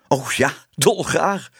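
gladly accepting, drawn out; eager yes. Acted. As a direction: savour the yes, delighted. Tibbe eagerly accepts job back.

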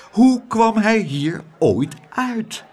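hoge, gerekte verzuchting; wanhopige vraag. A narrator's reading. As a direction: vraag het langzaam, radeloos. Verteller verwoordt Abeltjes benauwdheid. (licht wanhopig)

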